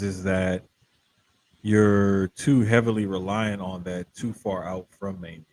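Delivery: usual speaking voice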